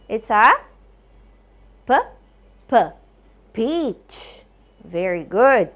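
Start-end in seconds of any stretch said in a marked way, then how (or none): 1.9-4.4 clipped p, p, then peach; phonics sounding out. Shown as a demonstration. Teacher sounds out peach for young learners, phonics style. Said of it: "let's sound out their sounds".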